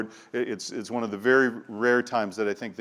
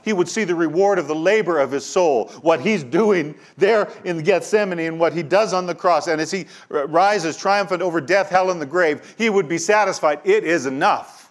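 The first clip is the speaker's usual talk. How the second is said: rising, louder preaching with growing fire; fervent preaching; 6 semitones higher, 5 dB louder than usual. Acted. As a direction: preach it up toward the triumph. Preacher builds to full force.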